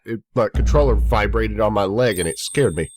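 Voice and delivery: usual speaking voice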